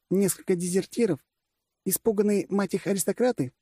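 high-pitched commander posing rhetorical questions; female generalissimus, scornful. Acted. Gadya derides the fallen empire. (высокий женский голос, с презрением)

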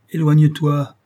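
angry command. Acted. Victor repousse le monstre.

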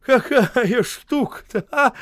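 high, loud slow grumble; high loud grumbling; loud grumble for all. A narrator's reading. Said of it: "заворчал громко для всех".

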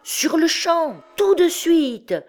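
high, loud angry-mob shout; crowd clamour. A narrator's reading. Crowd threatening actors and cardinal, loud high clamour. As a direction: shout it like a mob baying for action. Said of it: "répétait la foule".

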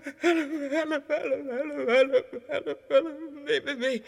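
loud, high desperate screams; screaming for help. Acted. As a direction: cry out in panic. Madman's breakdown cry for help. (wanhopig schreeuwend om hulp)

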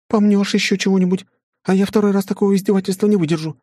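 put-on high, fast, indignant girl; fussy young woman voice. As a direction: complain high and quick, fussing. Flora scolds husband.

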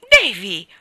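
very loud scandalized exclamation; shocked reproach. A narrator's reading. Anna scandalized by Davy's irreverence, shocked reproach. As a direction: cry the name out as a sharp rebuke. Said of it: "convinto dal tono di Anna di aver detto qualcosa di terribile".

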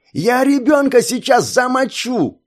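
high, slow furious roar; crazed yell. Acted. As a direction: yell the threat like a madman. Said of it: "орал обезумевший Резо". (бешеный ор)

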